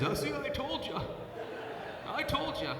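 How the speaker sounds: imitating his dad's chuckling I-told-you-so; gloating dad. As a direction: crow it like a triumphant dad. Dad gloats, son laughing.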